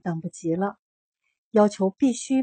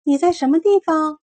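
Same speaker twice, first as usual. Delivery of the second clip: calling out; 7 semitones higher, 6 dB louder than usual. A narrator's reading. Friends call to hidden voice.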